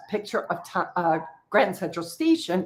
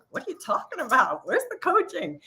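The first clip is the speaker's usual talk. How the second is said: confused newcomer; 7 semitones higher than usual. Retold or imitated. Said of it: "they're like".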